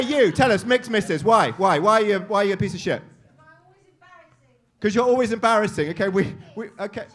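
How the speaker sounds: ordinary talk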